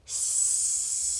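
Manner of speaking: soft breathy hiss like steam; long unvoiced sss; snake hiss. A narrator's reading. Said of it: "she heard a hissing noise".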